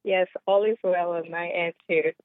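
ordinary delivery

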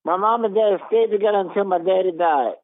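usual speaking voice

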